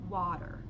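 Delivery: "water" with an open aw vowel and a flapped t; American water. Shown as a demonstration. Teacher modelling the vowel in water. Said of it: "Ó".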